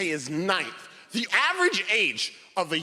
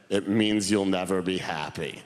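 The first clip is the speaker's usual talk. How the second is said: lower, heavy grandfather voice; 9 semitones lower than usual. Retold or imitated. Grandfather answering the boy, lower voice. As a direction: deliver it as the gloomy old man.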